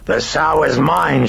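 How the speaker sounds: high, loud, slow demonic bellow; coarse bellow. A narrator's reading. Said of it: "she bellowed in a coarse and powerful voice".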